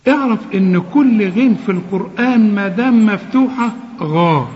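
usual speaking voice

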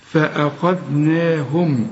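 فأخذناهم drawn out slowly; slow tajweed reading; slow recitation. Shown as a demonstration. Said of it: "هنا ما نقولش".

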